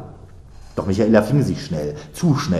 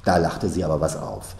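usual speaking voice

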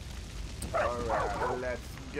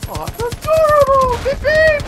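delighted squeal; 12 semitones higher, 7 dB louder than usual. Real, not acted. Delighted at a helicopter.

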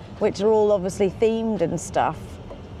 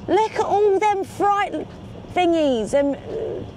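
much higher, drawn-out enthusiasm; excited pointing out; 9 semitones higher, slower than usual. Real, not acted. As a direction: marvel at it, pitch jumping up. Enthusing about docks, pointing out freight.

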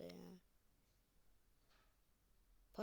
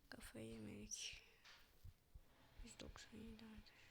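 much softer whispered aside; 14 dB quieter than usual. Real, not acted. Streamer whispering an aside to friends watching. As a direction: whisper it off to the side.

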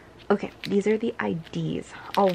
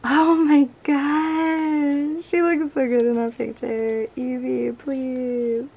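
excited squeal; 4 semitones higher, 5 dB louder, slower than usual. Real, not acted. Squealing over favorite member.

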